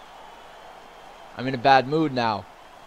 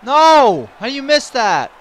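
high, very loud outraged shout; exasperated yell; 12 semitones higher, 15 dB louder, slower than usual. Real, not acted. Gamer at a missed shot, exasperated yell. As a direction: scream in disbelief at a miss.

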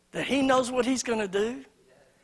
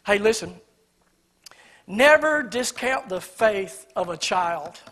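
ordinary delivery

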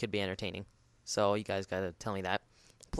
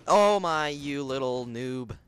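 slow, drawn-out scolding; indignant; 5 semitones higher, 7 dB louder, much slower than usual. Real, not acted. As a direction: drag it out indignantly. After being killed.

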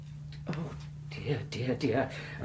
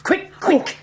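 higher, louder urgent command; 12 semitones higher, 5 dB louder than usual. Acted. Doctor urges the housekeeper to fetch scissors fast. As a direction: hurry her along.

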